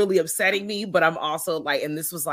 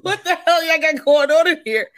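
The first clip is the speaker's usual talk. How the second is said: high, loud, laughing outrage; cackling through the shock; laughing incredulous what the fuck; laughing disbelief; 11 semitones higher, 8 dB louder than usual. Real, not acted. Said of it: "I'm pissed about it".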